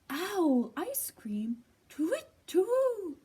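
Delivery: slow whispered gasp into a high loud hoot; a frightened owl squawking; panicked owl hoot. Acted. Owl hearing he is the gruffalo's food, panicked hoot.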